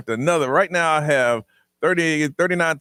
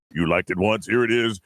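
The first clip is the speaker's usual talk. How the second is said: much lower booming announcer; deep announcer voice; 9 semitones lower than usual. Retold or imitated. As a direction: boom it like a radio announcer. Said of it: "the guy with the big deep voice".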